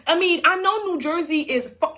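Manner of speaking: ordinary talk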